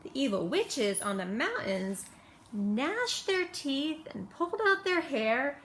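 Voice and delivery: ordinary delivery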